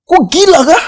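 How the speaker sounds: very high, loud incredulous outburst; high-pitched mock outrage; Pak Ian. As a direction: mimic an exasperated boss in his own way of talking. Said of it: "gitu, pakai bahasa Bapak".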